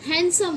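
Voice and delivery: „हैंडसम” as HAN-sum; silent d. Shown as a demonstration. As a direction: leave the d out of हैंडसम. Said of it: "ड नहीं होता".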